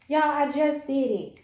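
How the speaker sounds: loud, proud call to viewers; triumphant. Real, not acted. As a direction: shout the win to everyone.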